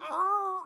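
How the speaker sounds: high mock creak of old floorboards; creaky wood noise. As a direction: imitate a wooden house creaking. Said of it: "without the wood going".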